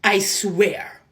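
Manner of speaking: ordinary delivery, nothing marked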